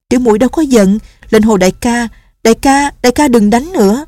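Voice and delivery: begging him to stop hitting himself; young nun, anxious pleading. Acted. Nun begs again. (giọng cô gái cao, hoảng hốt van nài)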